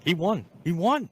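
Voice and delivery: retold thrilled yell; excited shout. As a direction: shout the win like you can't believe it. Retelling the finish.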